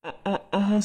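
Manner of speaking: faint strained groaning on waking; weak groans. Acted. Sick father waking in hospital bed, weak groans. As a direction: groan softly, barely able to speak.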